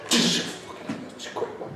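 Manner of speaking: muttered aside. Retold or imitated. Imitating friend muttering while sparring.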